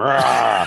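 comic roar while chasing; monster roar. Acted. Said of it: "[name]'s there chasing them".

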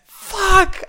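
very high, loud burst; gleeful yell; excited swear. Real, not acted. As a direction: shout it out in delight. Excited recap of dramatic reveal.

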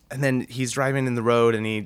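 ordinary delivery

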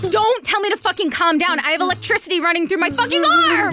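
panicked yelling. Acted. Panicked mutant powers bit.

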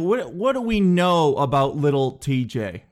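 ordinary talk, nothing marked

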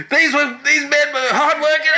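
high-pitched imitation of an outraged mayor; mock indignant abuse. Imitating the mayor abusing people over dead city officials, mock indignant. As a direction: act out scolding the whole room. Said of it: "abusing people".

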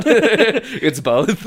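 usual speaking voice

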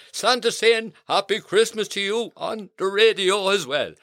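ordinary delivery

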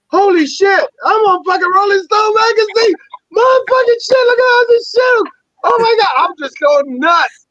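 very high, squealing excitement; re-enacted ecstatic freak-out. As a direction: squeal it like you just found yourself in a magazine. Retold discovery in Rolling Stone.

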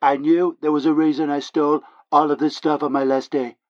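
ordinary delivery

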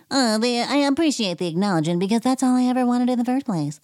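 high, fast, airy imitation; mock Erica. Vlogger mocking Erica's smug acknowledgement line, high and fast. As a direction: mimic her smug obliviousness.

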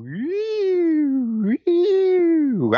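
high swooping siren-like whistle; radio frequency whine. Acted. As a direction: squeal like a radio being tuned, way up high. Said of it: "like, a radio frequency".

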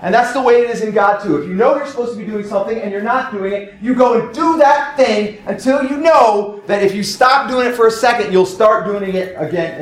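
fervent pulpit exhortation; preaching at full force. Acted. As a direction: press the point home to the congregation. Preaches on to-do priorities.

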